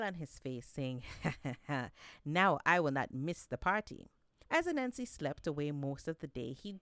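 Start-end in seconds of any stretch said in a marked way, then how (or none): 1.1-2.2 pleased little chuckle; sly chuckle. Acted. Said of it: "with a smile". 2.2-4.1 louder, triumphant boast; gleeful. Acted. Anansi gleeful at his plan.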